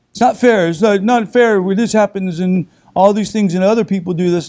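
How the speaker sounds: hammed-up child's protest; whiny child voice. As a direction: whine it like a sulky child complaining. Mocking a complaining child.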